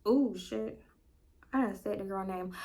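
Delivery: soft guilty mutter; hushed oops. Retold or imitated. Caught out, muttered aside.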